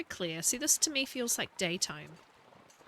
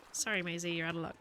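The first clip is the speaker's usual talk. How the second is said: normal talking voice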